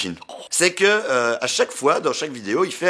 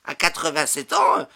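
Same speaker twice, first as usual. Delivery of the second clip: lightly voiced old lady; 6 semitones higher than usual. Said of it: "elle répond".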